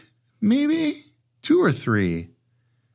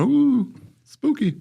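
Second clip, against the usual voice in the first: mock spooky; much slower than usual.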